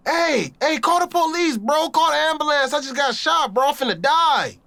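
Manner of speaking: high, frantic calling for help; panicked shout. Retold or imitated. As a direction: yell for help, scared. Calling for help after being shot.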